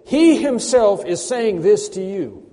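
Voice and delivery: loud, high, slow preaching climax; emphatic proclamation. Acted. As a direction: drive every word home with full force. Preacher's climax on God speaking directly.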